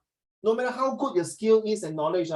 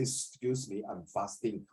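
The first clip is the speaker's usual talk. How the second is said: low, hushed mock reproach; acted subdued, low-voiced complaint; quiet objection; 8 semitones lower, 10 dB quieter, slower than usual. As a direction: drop to a soft, low objection. Acted fasting student objecting quietly.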